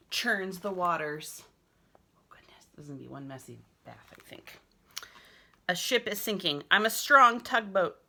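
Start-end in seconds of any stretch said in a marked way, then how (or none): 2.0-4.6 fast, soft whisper; whispered aside. Real, not acted. Teacher whispering a joke about the messy bath, hushed. 5.7-8.0 loud, high proud tugboat; excited play. Pretend. Boy pretending to be a tugboat in the bath, loud excited.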